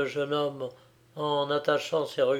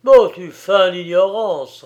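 angry retort; 4 semitones higher, 10 dB louder than usual. A narrator's reading. Giovanni retorts angrily.

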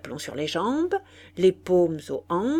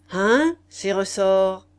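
s'écrie plus fort et lentement, fier de ses ressorts; exclamation; 7 dB louder, slower than usual. Acted. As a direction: marquis qui s'exclame, lent et appuyé. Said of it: "s'écria le marquis". (s'écrier avec emphase)

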